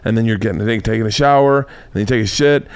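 normal talking voice

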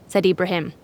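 ordinary delivery

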